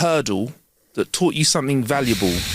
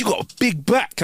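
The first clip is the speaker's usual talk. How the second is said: imitated stranger; 8 semitones higher than usual. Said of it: "He goes".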